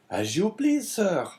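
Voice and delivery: high eager innkeeper voice. A narrator's reading. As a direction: answer like a surprised servile host. Innkeeper voice in story.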